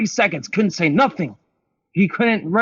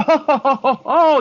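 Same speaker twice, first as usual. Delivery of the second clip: mock realisation; 10 semitones higher, 3 dB louder than usual. Realising boyfriend's lie.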